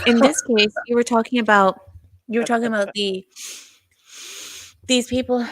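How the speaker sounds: breathless, gasping between phrases; out of breath. Real, not acted. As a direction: talk while catching your breath. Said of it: "I'm a little bit out of breath".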